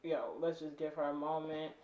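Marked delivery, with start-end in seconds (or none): none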